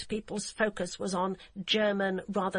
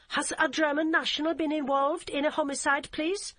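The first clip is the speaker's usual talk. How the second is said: put-on heavy German accent; 8 semitones higher, 4 dB louder than usual. Hamburg policewoman answering the phone in a curt, heavy German accent. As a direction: clip it like a stiff German officer. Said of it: "with an accent so heavy".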